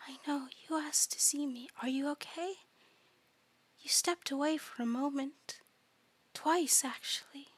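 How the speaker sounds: soft, whispery eldritch coo; hushed, tender monster voice; eerie doting creature. Acted. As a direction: coo softly and sweetly from the dark.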